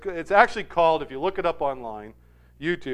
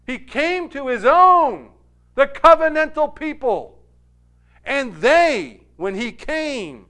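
high, loud pulpit delivery; forceful preaching; 8 semitones higher, 8 dB louder than usual. Real, not acted. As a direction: preach it with long, weighted key words.

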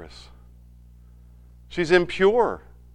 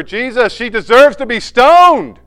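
loud, slow, insistent demand; accusers' demand; 7 semitones higher, 12 dB louder than usual. Retold or imitated. Pastor voicing the accusers before Jesus, loud demanding. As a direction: hammer out the accusation, slowing down.

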